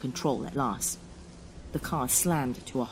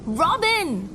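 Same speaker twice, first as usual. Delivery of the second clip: very high, loud screamed name; anguished scream; 14 semitones higher, 7 dB louder than usual. Acted. Man sees girlfriend's body carted away, screaming. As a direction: scream for the one on the gurney. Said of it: "I screamed".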